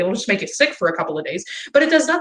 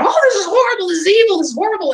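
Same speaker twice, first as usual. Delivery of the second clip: high, loud, hammed-up gasp of horror; mocking outrage; 12 semitones higher, 4 dB louder than usual. Mocking the churches and pro-life ministries, high hammed-up outrage. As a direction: parody the church crowd's shocked alarm. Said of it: "all the churches and all the pro-life ministries jumped on it".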